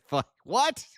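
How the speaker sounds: very high, loud startled squawk; mock shocked gasp. Parody eureka moment, hammed-up shocked squawk. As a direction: yelp it in fake shock. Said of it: "I said".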